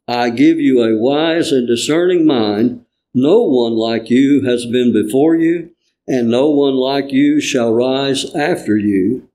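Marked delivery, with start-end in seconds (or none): none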